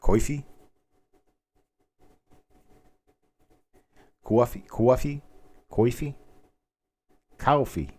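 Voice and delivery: "Coffee?" tried several ways, kʰwe- to kʰal-; practicing southern coffee. Shown as a demonstration. Working out the Mid-South "coffee". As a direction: try out vowels on "Coffee?". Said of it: "Y'all want another cup".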